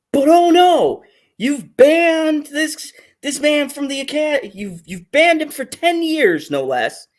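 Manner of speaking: sarcastic outrage; sarcastic rant. Real, not acted. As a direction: sneer at the ban. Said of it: "I'm gonna bring it".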